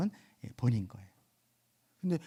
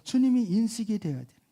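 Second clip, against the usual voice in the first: normal talking voice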